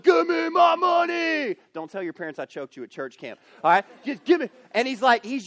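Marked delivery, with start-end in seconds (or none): 0.0-1.6 slow, loud, higher yell of demand; choking shout. Acted. Servant shouting at his debtor friend, loud and slow. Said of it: "just starts like". 4.1-4.5 loud higher grab for the money; demanding shout. Acted. Servant choking his friend, shouting loudly. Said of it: "just starts like".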